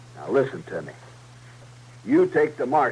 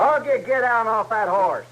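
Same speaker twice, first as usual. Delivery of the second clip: angry shout; 10 semitones higher than usual. Acted. Outlaw yelling at his partner to get off the horse, angry shout. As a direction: yell him down hard, losing patience.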